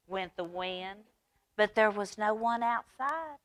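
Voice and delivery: plain reading voice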